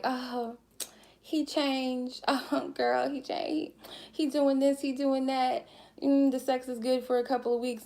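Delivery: high-pitched mock girl-talk gush; gushing girlfriend. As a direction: brag to your girlfriend in a put-on high voice. Voicing a woman gushing about boyfriend.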